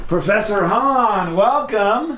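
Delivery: high, stretched-out welcome; hearty greeting. Pretend. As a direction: sing out his name in greeting. Greets guest on walking in.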